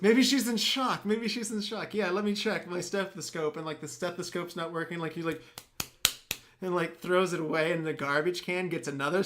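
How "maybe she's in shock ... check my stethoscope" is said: high, loud mock-earnest Bruce Willis, slowing at first; lightly voiced flustered doctor. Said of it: "Bruce Willis is like".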